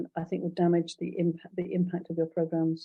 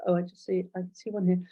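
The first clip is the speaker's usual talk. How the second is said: ordinary delivery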